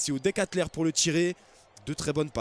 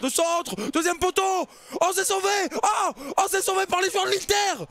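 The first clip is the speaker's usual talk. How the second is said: excited call; 12 semitones higher than usual. Acted. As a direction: yell the clearance off the line. Goal-line save called out.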